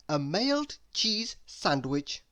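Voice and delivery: usual speaking voice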